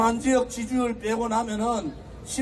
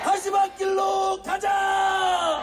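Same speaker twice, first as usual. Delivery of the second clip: slow, roaring rally chant; roaring rallying speech; 5 semitones higher, much slower than usual. Acted. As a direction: rouse the crowd, word by word.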